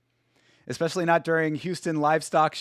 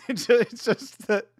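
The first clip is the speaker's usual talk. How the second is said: laughing; 7 semitones higher than usual. Real, not acted. Caught laughing at a story.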